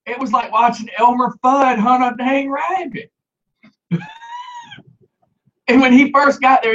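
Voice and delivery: ordinary talk, nothing marked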